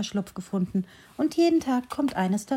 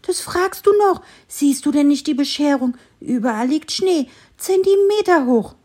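much higher, louder indignant squirrel; angry squirrel; 6 semitones higher, 6 dB louder than usual. A narrator's reading. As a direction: snap back in a huffy squirrel voice. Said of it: "antwortete das Eichhörnchen erbost". (erbostes Eichhörnchen, viel höher und lauter)

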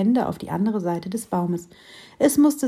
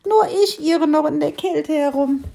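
ladybird; 7 semitones higher, 4 dB louder than usual. Acted. Ladybird lamenting.

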